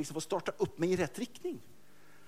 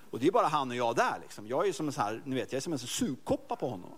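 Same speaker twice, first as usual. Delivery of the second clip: ordinary delivery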